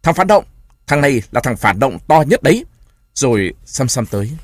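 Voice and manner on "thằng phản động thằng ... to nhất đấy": loud, high accusing yell; furious shout. A narrator's reading. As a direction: scream the accusation at him. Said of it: "Trưởng thôn Pàng thét to".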